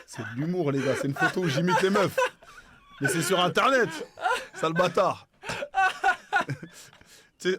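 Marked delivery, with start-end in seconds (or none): 0.1-5.3 loud words through laughter; laughing. Real, not acted. Laughing while defending himself. 5.4-6.8 loud high-pitched cackle; high laugh. Real, not acted. Big laugh after insult.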